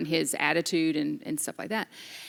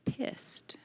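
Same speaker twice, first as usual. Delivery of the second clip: breathy whisper of the word; whispered taboo word; 9 dB quieter than usual. Real, not acted. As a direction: drop to a whisper on the naughty word.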